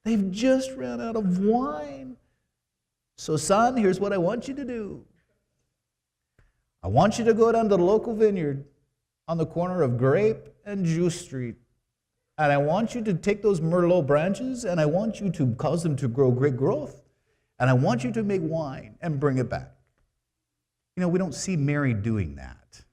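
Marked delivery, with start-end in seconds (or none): 0.0-2.1 high, slow, syrupy mock-mother voice; hammed-up high sugary mother voice; mock sweet Mary. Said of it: "She didn't say". 3.2-19.7 high, louder mock mother giving orders; high pretend bossy mom; mock bossy Mary. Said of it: "She didn't say".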